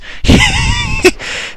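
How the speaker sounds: high-pitched disbelieving laugh. Real, not acted. Laughing at the enemy's tiny army, high squeaky laugh. Enemy has only two units.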